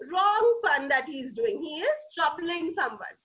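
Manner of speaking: usual speaking voice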